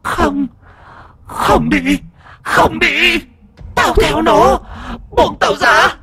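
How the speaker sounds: very high screeching protest; wailing possessed woman. A narrator's reading. Possessed woman struggles against the healer, high screaming wail. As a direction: scream and wail as a spirit resisting exorcism. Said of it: "Nga giãy giụa gào khóc".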